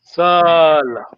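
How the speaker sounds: Sala with its vowel held long. Shown as a demonstration. Teacher modelling the long vowel in Sala. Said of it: "it is long vowel".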